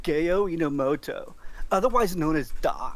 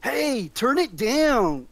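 calling out; 6 semitones higher, 4 dB louder, slower than usual. Acted. Doc calls to loud goblins.

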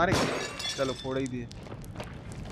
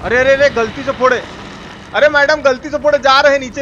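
loud, higher flustered yelling; panicked shout; 5 semitones higher, 6 dB louder than usual. Real, not acted. Gamer apologizes to madam after misfire, panicked loud shout. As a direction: blurt it out in a panic. Accidental blast, panicking in game.

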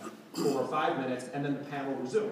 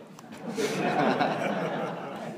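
a short airy laugh; real laughter between lines; breathy laugh; 5 dB louder than usual. Real, not acted. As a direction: laugh off the memory. Laughing while telling story.

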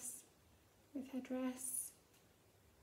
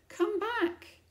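higher, louder sharp call; cross snap; 9 semitones higher, 9 dB louder than usual. Acted. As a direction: bark it after a runaway child. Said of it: "snapped the cross governess".

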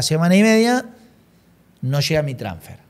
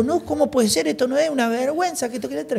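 higher-pitched, worked-up protest; mock distress at the bank; upset protest; 10 semitones higher, 3 dB quieter than usual. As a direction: protest like the money is gone.

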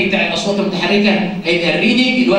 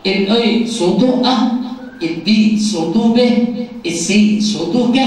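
slow demonstration; slower than usual. Shown as a demonstration. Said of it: "انا ممكن اقول".

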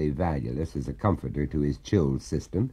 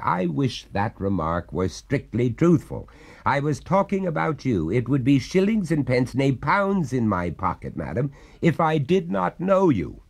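lightly voiced indignant householder; wry, rising protest; exasperated gentleman; 6 semitones higher than usual. Master replies to the ghost.

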